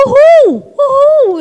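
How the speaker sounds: high, loud whooping "uhul"; joyful whoop. Retold or imitated. Mother cheering through the turbulence, high loud joyful whoops. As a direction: whoop with glee like on a ride. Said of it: "começou a gritar de alegria".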